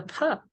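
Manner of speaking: fa as p plus a puff of air; aspirated P. Shown as a demonstration. Teacher showing the unwritten H, aspirated fa. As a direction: say fa as pha, H after the P. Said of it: "but you have to pronounce".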